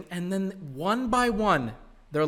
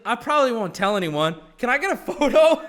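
louder, higher, laughing at the end; louder eager fan voice, laughing; mock eager instructor; 5 semitones higher, 10 dB louder than usual. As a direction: beg for the photo while laughing. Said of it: "He's like".

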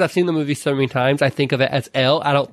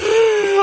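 high, loud hammed-up yawn; mock yawn; 4 dB louder than usual. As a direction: yawn right into the mic. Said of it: "you're like".

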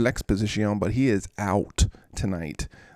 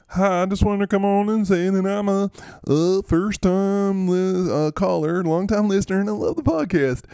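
higher, louder put-on radio caller; fake caller voice; 9 semitones higher, 4 dB louder than usual. [name] calling into the podcast, high put-on voice. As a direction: call in like a nervous fan. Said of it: "Uh, Mister Reed".

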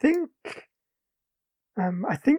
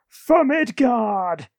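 slow, drawn-out warrior cry, raised and loud; mock battle yell; 5 semitones higher, 6 dB louder, much slower than usual. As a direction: yell it like a god rallying for battle. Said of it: "Thor's kind of standing there yelling".

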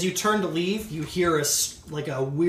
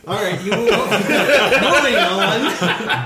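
talking through laughter; laughing; 5 semitones higher, 6 dB louder, slower than usual. Real, not acted. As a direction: laugh while you speak. Laughing through stream banter.

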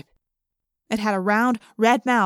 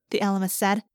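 ordinary delivery

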